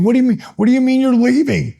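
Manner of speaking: panicked plea to a friend walking out; panicked disbelief. Retold or imitated. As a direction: beg him not to walk out. Panicked retold protest.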